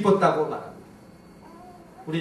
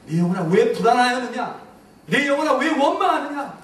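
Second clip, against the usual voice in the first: loud, high rebuking questions; commanding the soul; 6 semitones higher, 3 dB louder than usual. Shown as a demonstration. Preacher modelling the command for the congregation, loud and high. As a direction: scold your soul for worrying. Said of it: "오더, 명령하십시오".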